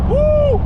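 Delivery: high, loud "woo"; excited whoop. Real, not acted. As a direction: let out a piercing whoop. Whoop during test drive.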